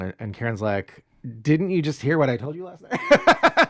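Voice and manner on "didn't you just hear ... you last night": lightly acted indignant outburst; mock-incredulous, pitch raised; retold exasperation. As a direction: imitate someone exasperated at not being heard. Karen snaps at Sid, retold.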